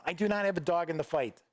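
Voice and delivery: ordinary delivery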